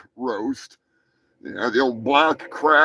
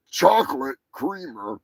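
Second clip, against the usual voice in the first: normal talking voice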